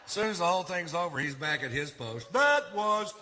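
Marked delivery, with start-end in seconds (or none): 2.3-3.1 high, loud, hugely drawn-out mock reproach; scolding conscience. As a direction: wail the scolding slowly, way up high. Conscience scolding him afterwards.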